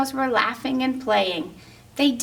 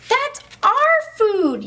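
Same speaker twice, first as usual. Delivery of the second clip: yelled; 11 semitones higher, 3 dB louder, slower than usual. Acted. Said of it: "yelled Apsator".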